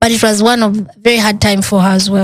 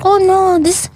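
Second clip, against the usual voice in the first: high, matter-of-fact doctor voice; blunt doctor; 7 semitones higher than usual. Retold or imitated. Doctor telling patient the pregnancy failed, high blunt voice. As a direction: state it flatly, pitched high. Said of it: "And she's like".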